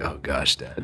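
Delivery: low, muttered mock groan at Dad; embarrassed kid. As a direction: imitate a kid quietly fed up with Dad. Imagined embarrassed kids reacting to dad.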